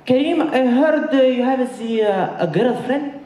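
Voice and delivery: put-on high, slow accented Egyptian mother; Egyptian mom voice. Son's Egyptian mom confronting him about a girlfriend, high accented voice. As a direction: play the suspicious Middle Eastern mom, high and drawn out.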